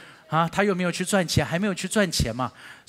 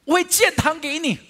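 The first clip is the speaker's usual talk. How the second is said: raised, higher pleading voice bargaining with God; loud prayerful vow; 13 semitones higher, 8 dB louder than usual. Retold or imitated. A believer vowing loudly to God to build a church, loud pleading. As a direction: call it out to God like an eager promise.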